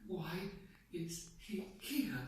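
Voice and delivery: slow, hushed, halting fear; fearful. Shown as a demonstration. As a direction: break after "Why", then softly, as if paralyzed. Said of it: "you know, fear".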